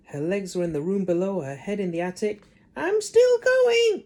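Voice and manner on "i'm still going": very high, slow, loud crone; old screechy voice. Acted. Grandma calling down from the attic, screechy. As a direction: screech from far above like a hag. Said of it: "came the old screechy voice".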